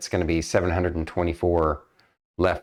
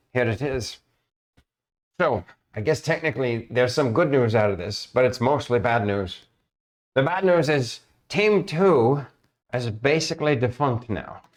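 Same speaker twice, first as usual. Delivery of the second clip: worried guild master, lightly voiced, a bit higher; guild master NPC; 7 semitones higher than usual. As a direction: GM playing a fretful guild master breaking bad news. GM voices Mister Zolk.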